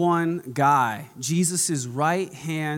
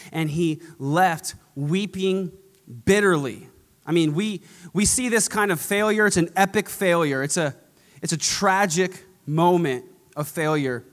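ordinary delivery

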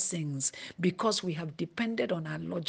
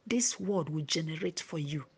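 ordinary delivery

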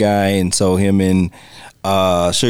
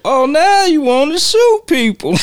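much higher, taunting; mock Vera; 3 dB louder than usual. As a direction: sneer up high at the pistol. Vera taunts Quick's pistol.

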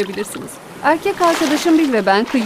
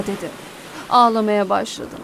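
ordinary delivery, nothing marked

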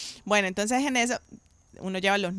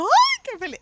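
much higher happy squeal; delighted squeal; 5 dB louder than usual. Retold or imitated. Answering her husband's cruise offer, a delighted high squeal. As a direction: squeal with joy at the cruise idea.

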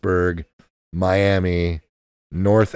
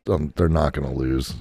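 normal talking voice

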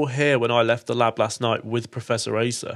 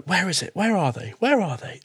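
frantic searching; 6 semitones higher than usual. Acted. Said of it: "She's like".

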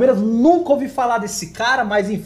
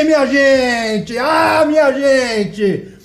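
drawn-out announcer call; 3 semitones higher, 3 dB louder, much slower than usual. Acted. Host opening the live show.

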